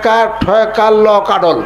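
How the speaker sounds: spelled out slowly in village dialect, kadol; ধীরে গ্রাম্য উচ্চারণে বানান; slow dialect spelling. Retold or imitated. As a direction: pupil drawing out each letter of কাডল. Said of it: "কয়".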